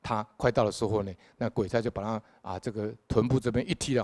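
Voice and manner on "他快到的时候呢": ordinary talk, nothing marked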